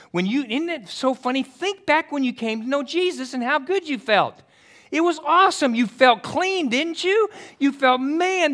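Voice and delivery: high, loud, joyful preaching; rising joyful sermon; fervent preaching. Acted. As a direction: lift the congregation up. Preaching at full force.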